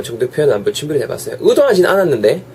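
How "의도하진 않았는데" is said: usual speaking voice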